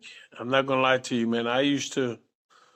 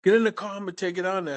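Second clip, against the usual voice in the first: high-pitched imitation of mom's order; scolding mother; 6 semitones higher, faster than usual. Mom ordering her boy into the car, high scolding imitation. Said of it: "She goes".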